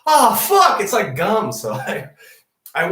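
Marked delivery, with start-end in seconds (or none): 0.1-1.5 dismayed. Retold or imitated. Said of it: "and I was like".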